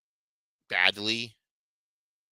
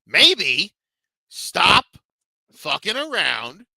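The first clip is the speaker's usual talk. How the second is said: loud rant; 7 semitones higher, 10 dB louder, much slower than usual. Real, not acted. Ranting advice at NBA player.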